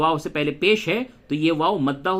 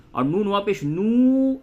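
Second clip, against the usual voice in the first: stretched vowel; 4 semitones higher than usual. Shown as a demonstration. Teacher shows waw lengthening the vowel, stretched نو. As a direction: spell it out, then hold the final نو. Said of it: "یہ تھوڑا کھینچ کے ہو گیا".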